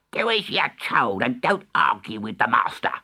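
cross cook's character voice; scolding cook. Acted. Cook ordering Dick not to argue, scolding. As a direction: tell the boy off as a bossy cook.

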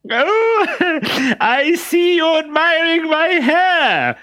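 very high, loud simpering falsetto; disguised Harlequin. Acted. Harlequin in a dress flirting with the captain, high simpering falsetto. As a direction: gush like an ugly coy bride.

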